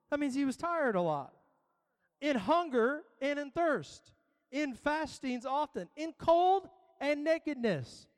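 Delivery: preacher pressing every peril on the crowd; preaching scripture. Acted. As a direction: build each peril like a drumbeat. Reads Paul's trials with rising force.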